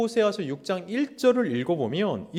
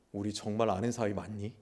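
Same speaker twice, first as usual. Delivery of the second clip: softly asking as God; 6 semitones lower, 6 dB quieter than usual. Acted. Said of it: "하나님 입장에서 이렇게 얘기하는 거예요".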